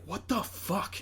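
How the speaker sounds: high, stunned exclamation; shocked. Retold or imitated. Viewer watching the outburst, shocked. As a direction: react in disbelief. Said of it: "And you're like".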